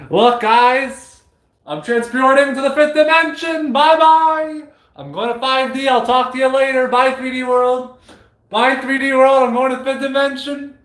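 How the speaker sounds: giddy mock blast-off; playful farewell. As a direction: wave off the world as you float away. Pretend departure to 5D.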